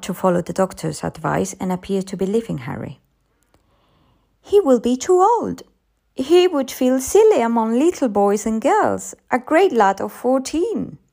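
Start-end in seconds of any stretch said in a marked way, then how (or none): 4.4-11.0 raised, emphatic persuading; insistent mother. A narrator's reading. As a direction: argue it high and loud to a child. Mother arguing with her child.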